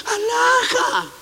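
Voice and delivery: hammed-up "how lovely" squeal; mock gushing squeal. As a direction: squeal like someone charmed by a pet. Gushing over the cute little animal.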